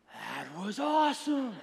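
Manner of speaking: slow, drawn-out mock cheer; imitated thrilled kid. Son to dad after first motorcycle ride, imitated excitement. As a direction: play a boy amazed by his ride.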